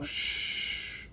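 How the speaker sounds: long soft shush; soft hissed shh. Acted. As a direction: hush them with a drawn-out shh. Frank shushes Bean.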